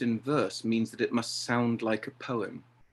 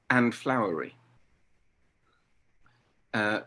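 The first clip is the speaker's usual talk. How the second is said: ordinary talk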